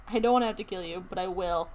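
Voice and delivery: ordinary delivery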